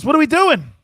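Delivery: high, loud quoted shout; booming high-pitched question; loud eager question. Retold or imitated. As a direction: call it out high and loud, acting out a teammate. Jerome's loud question, acted out.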